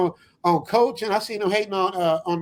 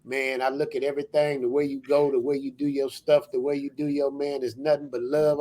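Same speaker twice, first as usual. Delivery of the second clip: [name] impression; 7 semitones lower than usual. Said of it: "let me do a quick, quick fifteen second version of [name]".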